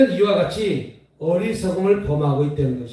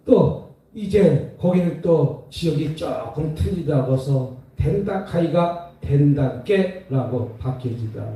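normal talking voice

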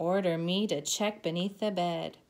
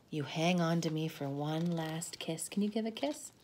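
ordinary delivery

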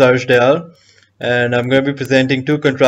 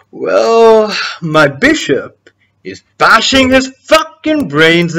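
drawn-out, high "well" into slow gloating; theatrical, slow menace; menacing relish; 9 semitones higher, 6 dB louder, much slower than usual. Acted. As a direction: savour the threat slowly, punching the violent words. Schemer gloats, building to violent image.

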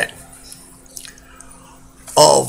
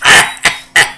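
cartoon laugh; 4 dB louder than usual. Laugh after good joke.

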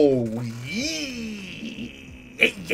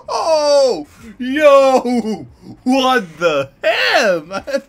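laughing; 6 semitones higher, 5 dB louder, much slower than usual. Real, not acted. Laughing at shocking reveal.

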